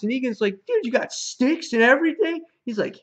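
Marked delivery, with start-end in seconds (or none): 0.7-2.5 high, loud excited question; hammed-up eager guest; thrilled. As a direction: act out disbelieving delight. Acting Negan thrilled by pool table.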